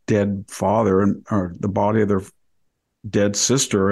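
ordinary delivery

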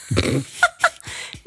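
breathless high laugh, no voice; high burst of laughter. Real, not acted. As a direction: burst out laughing at the funny word. Mother laughing at son's word. (hohes, atemloses Auflachen)

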